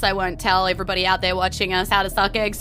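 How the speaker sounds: ordinary talk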